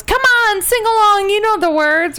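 high, loud eager cheer; rally everyone to sing along; Ross urging them. Retold or imitated. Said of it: "Ross goes".

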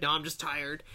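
low, quiet mock denial; imitated brush-off. Host imitating her friend denying emotion, low quiet brush-off. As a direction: drop low and soft, imitating a friend's excuse.

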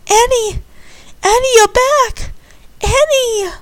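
high excited cries; overjoyed. Acted. Candy finding Annie back home, high and joyful. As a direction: cry out with joy.

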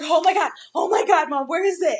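loud panicked oh my God; panicked kid. Retold or imitated. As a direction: imitate the son freaking out over the spider.

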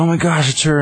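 high-pitched imitation of an alarmed crowd; mock panic. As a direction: squeal it like a startled bystander.

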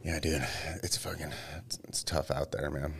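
hushed, breathy whisper. Real, not acted. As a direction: drop to a near whisper. Muttered aside during podcast chat.

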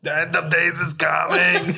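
loud, high doom proclamation; hammed-up apocalyptic shout; mock doomsday cry. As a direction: holler it like a street-corner prophet of doom.

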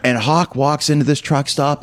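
ordinary delivery

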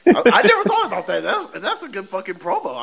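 high, laughing realisation; amused surprise. Real, not acted. Fan laughing at a clever promo idea.

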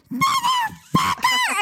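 very high, drawn-out mock fury; pissed. Woman who banged her head, long high furious curse. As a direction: stretch the curse into a squealing outburst. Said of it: "I just get so pissed".

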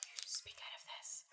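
soft whisper with no voice. Shown as a demonstration. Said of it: "instead of talking out of your throat".

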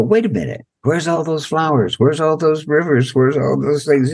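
high, wondering voice of a newborn soul; puzzled newborn soul. Acted.

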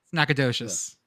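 Nacogdoches as NAK-uh-DOE-chez, G dropped; Nacogdoches, skip the G entirely; correct pronunciation. Shown as a demonstration. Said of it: "That G just".

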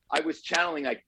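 usual speaking voice

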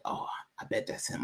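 whispered hopeful guess; hushed inner thought. Retold or imitated. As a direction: breathe it to yourself.

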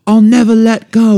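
high, loud dramatic vow; mock heroine vow. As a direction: ham up the movie promise. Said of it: "she's like".

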